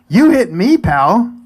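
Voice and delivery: high, loud indignant comeback; retold outraged objection; indignant retort. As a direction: throw it back at the other driver, offended. Retold retort to the other driver.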